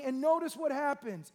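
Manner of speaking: ordinary delivery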